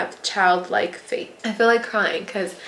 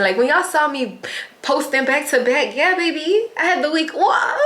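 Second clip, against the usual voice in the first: high-pitched, celebrating; elated, pitched-up bragging; gleeful; 6 semitones higher than usual. Real, not acted. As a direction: let it climb into a happy, laughing high. Celebrates having the week off.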